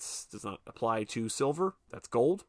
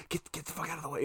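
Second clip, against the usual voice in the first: hushed urgency; 5 semitones higher, 8 dB quieter, much faster than usual. Retold or imitated. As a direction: imitate shooing someone off under your breath. Imitating hidden host shooing someone.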